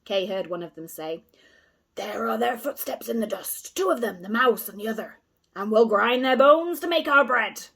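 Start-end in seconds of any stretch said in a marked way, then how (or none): 1.9-7.7 rough wolf voice, louder toward the end; gloating pirate wolf building to a roar; menacing wolf. A narrator's reading. Drunken wolf gloating over the children's tracks, growing louder.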